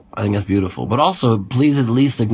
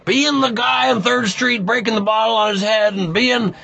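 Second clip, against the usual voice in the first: high imitation of the editor; 12 semitones higher than usual. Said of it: "she's like".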